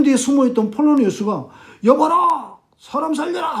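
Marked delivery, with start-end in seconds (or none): 1.8-3.6 high, drawn-out mock shout; acted-out cry for help; calling the guards. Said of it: "하고 호위병을 부른 거예요". (높게 외쳐 호위병을 부르는 시늉)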